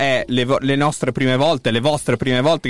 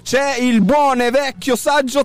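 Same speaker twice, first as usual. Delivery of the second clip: voce alta da presentatore, carica e sostenuta; annuncio radiofonico d'apertura; 8 semitones higher than usual. Acted. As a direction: apri la trasmissione lanciando ogni nome con enfasi. Apertura da presentatore dopo la sigla. (presentatore radiofonico carico, su toni acuti)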